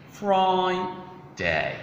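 "Friday" split as "Fri... day"; syllable by syllable. Shown as a demonstration. Teacher breaking the word down for learners, slow split syllables. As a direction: hold "Fri" high and long, then drop on "day". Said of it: "how to pronounce days of the week".

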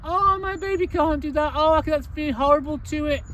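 put-on high, fussing baby-talk whine; mock the soppy owner protecting her baby; mocking doting owner. Owner mocked for babying the dog, high fussing whine. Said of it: "treating it like a little baby".

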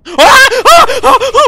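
high loud panicked screams; repeated screaming. Real, not acted. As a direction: scream again and again in terror. Chased by monster.

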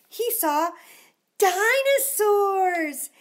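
high, loud, slow dramatic reveal; high loud drawn-out wonder; big reveal. A narrator's reading. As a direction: draw out the reveal of dinosaurs. Climax of the list.

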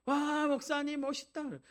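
put-on high, slow, delighted kids' cheer; children cheering. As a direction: call out like an excited Sunday school kid. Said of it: "외쳐줘요".

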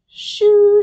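high, loud burst; shooing away. A narrator's reading. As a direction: shoo someone out the door. Mom sends Froggy outside.

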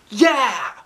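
put-on high, loud kids' cheer; kids shouting. The kids answering their teacher, cheering loudly. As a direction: shout it as a whole class. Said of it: "we all shouted".

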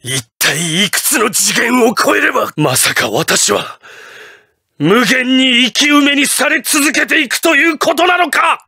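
strained start climbing to a high cry; mounting panic. Acted. Villain realising he is endlessly buried alive, mounting panic. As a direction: build from dismay into desperate shouting.

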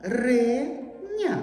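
"Варення" broken into three slow syllables; slowly counted syllables in "Варення". Shown as a demonstration. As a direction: sound out "Варення" with a pause after each syllable. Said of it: "Теж три склади".

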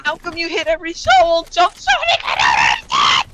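high, loud furious shouting; angry shout. Real, not acted. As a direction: rage at the game. Raging after being shot.

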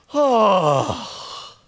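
high, pained groan; weary groan. Shown as a demonstration. Pastor demonstrating the old breath, a weary groan. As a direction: groan like the day is too much. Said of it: "change our breath prayer".

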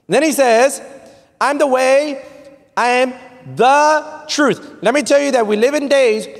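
high, declaiming pulpit voice; forceful preaching. Real, not acted. Preacher declaring the truth is under attack, forceful preaching. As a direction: proclaim it to the whole congregation.

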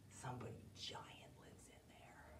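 hushed, unvoiced whisper. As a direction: whisper so the giant won't wake. Jack whispers near giant.

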